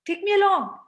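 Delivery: put-on a little kid begging to tag along; child asking. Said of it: "walks up and says".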